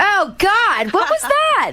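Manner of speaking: very high startled squeal; startled shriek. Real, not acted. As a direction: jump way up in pitch, caught off guard. Reacting to a strange sound.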